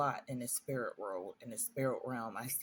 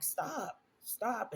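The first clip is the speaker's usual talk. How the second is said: retold yell; 7 semitones higher than usual. Child waking to being choked, retold yell. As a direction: cry "stop" in a raised pitch, holding back volume. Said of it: "I did get to yell".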